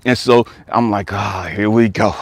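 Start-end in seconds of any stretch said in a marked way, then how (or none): 1.1-2.1 brace yourself for someone's emotional outburst; here-it-comes groan; weary dread. Retold or imitated. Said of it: "I can't stand people getting emotional".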